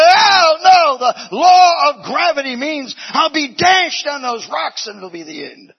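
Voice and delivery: high, loud frightened shout; terrified outcry. Acted. Bird at the edge, shouting in terror of the fall. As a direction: shout it out in dread.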